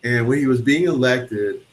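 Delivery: ordinary talk, nothing marked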